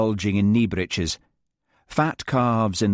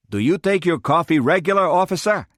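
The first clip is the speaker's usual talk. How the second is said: put-on American accent; 9 semitones higher, 5 dB louder than usual. A narrator's reading. Marvin American voice.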